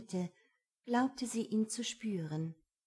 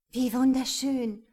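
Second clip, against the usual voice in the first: called out louder in awe; breathless wonder, louder; delighted exclamation; 3 semitones higher, 8 dB louder than usual. A narrator's reading. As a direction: cry out in delight at the view. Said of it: "rief sie".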